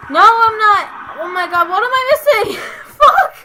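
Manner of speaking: high loud laughing scream; panicked laughing shriek. Real, not acted.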